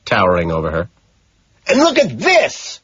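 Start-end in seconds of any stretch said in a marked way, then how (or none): 1.7-2.8 high, slow, gloating cry; triumphant scream. Acted. Killer holding up a cigar, triumphant high scream. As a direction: crow over her in triumph. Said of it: "I scream triumphantly".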